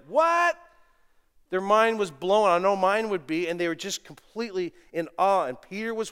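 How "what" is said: high, loud astonished what; astonished disciples. Retold or imitated. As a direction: gasp it out, amazed. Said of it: "They were like".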